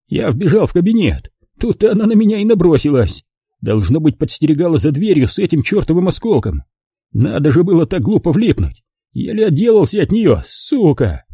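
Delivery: высокий голос пострадавшего инспектора; рассказывай высоко, с досадой на нападавшую; взбудораженный раненый инспектор. A narrator's reading. (инспектор жалуется высоким голосом)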